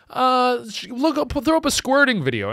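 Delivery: high, hesitant put-on buddy voice; sheepish buddy. Shy buddy asking his friend for weirder porn, high and sheepish. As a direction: squeak out an embarrassing request.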